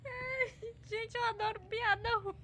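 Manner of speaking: high laughing through words; squealing with laughter. Real, not acted. Laughs after bad joke.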